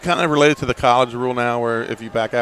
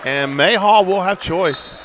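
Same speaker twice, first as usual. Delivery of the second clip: raised announcer pitch throughout; live play-by-play; 6 semitones higher than usual. Real, not acted. Announcer closes second period for listeners, live play-by-play. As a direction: announce the period's end to the audience.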